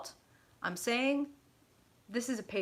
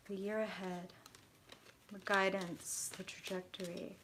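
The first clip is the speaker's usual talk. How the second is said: hushed invocation; 7 dB quieter than usual. Real, not acted. Reader asking Spirit for Pisces guidance, hushed invocation. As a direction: murmur it reverently, with long pauses. Asks Spirit while shuffling cards.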